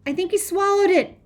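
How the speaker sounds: high, loud panicked shout; frantic girl's yell. A narrator's reading. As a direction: shout the news after someone running off. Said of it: "I hear Teresa shout".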